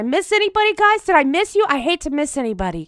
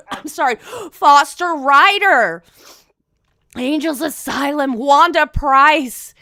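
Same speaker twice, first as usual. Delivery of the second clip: roll call announcer; 5 dB louder than usual. Acted. Reads the roll call loudly.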